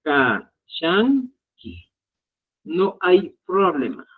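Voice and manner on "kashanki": "kachanki" very slowly, syllable by syllable as ka-shan-ki; modelled pronunciation. Shown as a demonstration. Teacher shows students how to pronounce, "kachanki" slow as ka-shan-ki. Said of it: "tu pronunciación va a ser".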